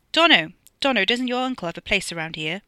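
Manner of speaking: hearty, carrying call; calling to a friend. A narrator's reading. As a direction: shout the name, then ask. Calls to friend from road.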